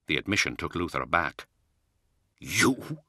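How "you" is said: disbelieving. Acted. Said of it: "he said disbelievingly".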